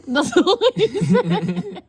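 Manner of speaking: slow, high, laughing punchline. Real, not acted. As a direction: giggle out the joke. Joke said laughing.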